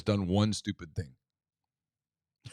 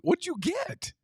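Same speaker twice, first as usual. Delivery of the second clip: high mock surprise; slower than usual. Dad voicing himself to daughter.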